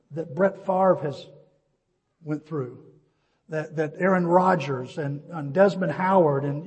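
ordinary talk

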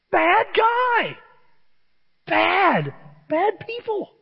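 very high, squealed warning; mock alarm. Preacher likening Saul to TV villains, high mock alarm. Ananias voice repeated as punchline.

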